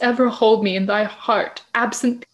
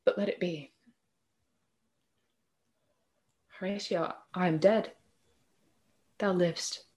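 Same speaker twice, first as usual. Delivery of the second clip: faint deathbed voice; 10 dB quieter than usual. Acted. Dying Hamlet fading.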